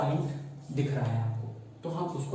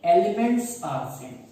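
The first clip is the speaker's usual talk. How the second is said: very slow and a little louder, stretched words; slow dictation; 3 semitones higher, 6 dB louder, much slower than usual. Shown as a demonstration. Teacher dictating the second rule to students, slowly. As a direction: dictate slowly so they can copy. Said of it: "और दूसरी बात क्या है?".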